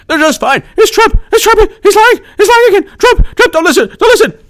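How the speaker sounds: shrill squeaky mock announcer, rising to a high frantic squeal; mock warning voice. Mocking social media warnings.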